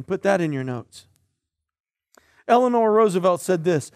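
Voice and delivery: ordinary delivery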